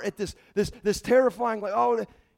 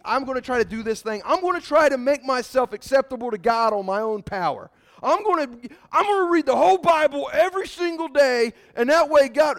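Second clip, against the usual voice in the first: higher, louder, eager striver voice; self-reliant striver; 7 semitones higher, 7 dB louder than usual. Acted. Preacher mocks the believer trying alone, in a raised eager voice. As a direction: play the earnest do-it-yourselfer, climbing in pitch.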